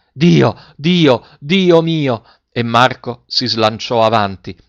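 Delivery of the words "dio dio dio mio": loud, high, overwhelmed outcry; three screamed cries. A narrator's reading. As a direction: cry out to God, each call separate. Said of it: "La donna urlò tre volte". (tre urla acute e sconvolte)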